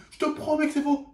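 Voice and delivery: high, pleading protest voice; imitating a panicked kid swearing he paid; protesting boy. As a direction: squeak out his innocence, mildly mocking. Boy swears it's false.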